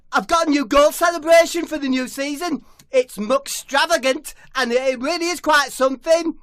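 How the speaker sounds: high, loud proud boast; high loud boastful footballer. Acted. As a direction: announce it grandly. Debbie proud announcement.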